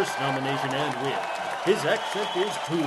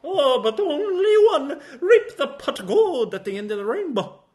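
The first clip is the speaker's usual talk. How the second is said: light put-on Irish lilt with a laugh; hammy leprechaun Irish; mock Irish accent; 11 semitones higher than usual. Presenter joking about the winner, in a mock Irish accent. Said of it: "Worst Accent".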